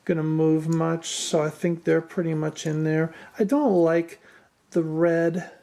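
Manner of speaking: ordinary delivery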